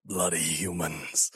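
gruff Aussie creature attempt; grumbling low Aussie; deep Australian. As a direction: try a deep voice with an Australian accent. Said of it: "hard to do a deep voice and Australian".

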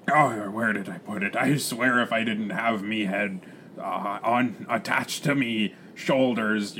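muttering pirate brogue, tailing off; flustered pirate captain. Acted. Captain hunts for parrot's food, grumbling pirate.

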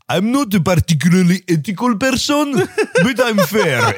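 mock Italian-Russian accent in English. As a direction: parody the film's odd accent, breaking into a laugh. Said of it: "elle fait genre".

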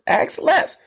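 EXCELLENCE cried out very high; high exclamation. Shown as a demonstration. Host hyping the excellence people ask for, high exclamation. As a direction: squeal EXCELLENCE like a triumphant slogan. Repeats word as emphatic exclamation.

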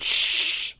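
long hushing "shh"; shushing. A narrator's reading. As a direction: hush the others. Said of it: "said Jake".

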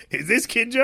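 high, loud, impatient question in imitation; mock angry demand. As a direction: demand it like the worked-up hero. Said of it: "He's so fucking mad".

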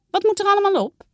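put-on a child keen to start the poster; curious girl.

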